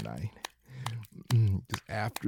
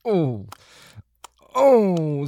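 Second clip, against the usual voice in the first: moans climbing higher and louder; rising moans; 9 semitones higher, 13 dB louder than usual. Acted. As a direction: let the moan swell upward. Lover's moans build.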